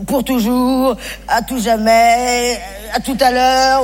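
very slow, drawn-out farewell; mock solemn. Comic drawing out a fake epitaph, very slowly. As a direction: stretch each word like a tombstone.

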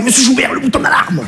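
high, urgent banker voice, pleading; the scared banker hissing at him during the hold-up; panicked banker. Retold or imitated. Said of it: "il m'a fait". (banquier paniqué, voix aiguë et pressante)

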